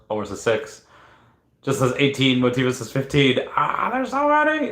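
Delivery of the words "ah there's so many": high-pitched wail; flustered high cry; overwhelmed. Real, not acted. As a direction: squeal at the flood of guesses. Overwhelmed by guesses.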